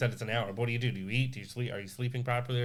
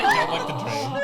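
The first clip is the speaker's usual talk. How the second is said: Brenda impression. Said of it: "She said".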